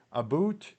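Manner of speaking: "a boot" for about, with an oo vowel; Canadian "about". Shown as a demonstration. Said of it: "or when I say".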